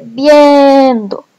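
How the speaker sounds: "viendo" with the e held long; stressed vowel. Shown as a demonstration. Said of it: "La última vocal acentuada es la E".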